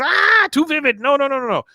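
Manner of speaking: very high, squealing no, no, no; squeamish son. Retold or imitated. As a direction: shriek at mama to stop talking. Son recoils from mother's romance.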